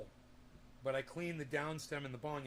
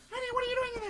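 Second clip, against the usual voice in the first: much higher, louder mock wife calling through the door; imitating wife; 7 dB louder, much faster than usual. Husband imitating his wife calling through the bathroom door, high mock voice. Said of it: "My wife, she'll come up to the door and she'll go".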